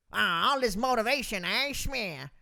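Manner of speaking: a mock nineteen-thirties mobster sneer; old-time gangster voice. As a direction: put on a corny old gangster act. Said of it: "a nineteen thirties gangster".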